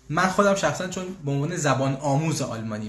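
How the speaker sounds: normal talking voice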